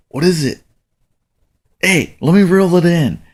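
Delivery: retold child's excitement; excited daughter. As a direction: pipe up like an excited kid wanting a turn. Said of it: "she's getting excited".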